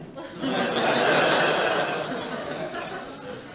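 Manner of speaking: breathy laugh. Real, not acted. Laughs at own punchline.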